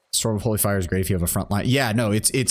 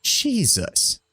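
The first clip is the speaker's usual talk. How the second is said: stunned; 11 semitones higher than usual. Real, not acted. Reacting to spell power.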